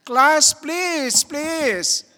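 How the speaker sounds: high, loud teacher-like scold, all in jest; hammed-up plea for an answer; mock scolding. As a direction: pretend to scold the silent congregation. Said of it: "I'm just kidding".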